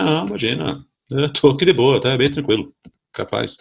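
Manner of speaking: high, breezy mock calm; ironic. To the nurse, ironically claiming to be relaxed. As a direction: pretend to be totally fine, sarcastically. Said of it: "Falei isso ironicamente".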